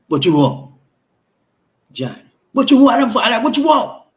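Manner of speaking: hammed-up furious husband, high and loud; angry husband. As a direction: play the hostile man who doesn't want to talk, loud and high. Role-play of an angry husband.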